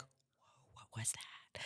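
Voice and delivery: stunned mock whisper; barely voiced, hushed disbelief; whispered reaction. Listener reacting to her accent, whispered.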